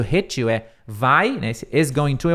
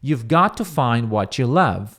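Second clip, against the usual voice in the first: slow model line; 2 semitones lower, slower than usual. Shown as a demonstration. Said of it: "ele diz o seguinte".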